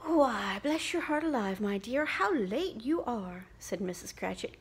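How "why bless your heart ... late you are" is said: louder, affectionate mother greeting; a mother fussing over her late daughter; fond mother. Acted. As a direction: scold her tenderly. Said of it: "kissing her a dozen times".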